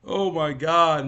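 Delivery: loud, drawn-out exasperated cry; angry exasperation. Real, not acted. Venting to viewers after screaming, loud angry exasperation. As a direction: let it out slowly and very loud. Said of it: "I'm fucking angry".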